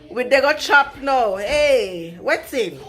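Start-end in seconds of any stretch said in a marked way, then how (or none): 0.1-1.4 Nigerian Pidgin attempt. Shown as a demonstration. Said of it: "How do you say that ... in pidgin English?".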